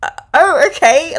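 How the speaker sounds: high, loud startled "uh, oh, okay"; taken aback. Retold or imitated. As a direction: caught off guard and flustered. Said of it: "And I was like".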